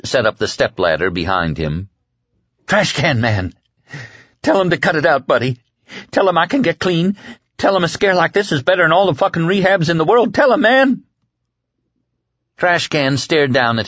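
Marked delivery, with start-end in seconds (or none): 2.7-11.0 high, urgent begging, rising at the end; desperate pleading. A narrator's reading. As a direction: plead for your life, growing more frantic. Condemned man begs for help.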